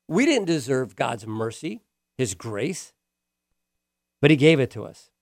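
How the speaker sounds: ordinary delivery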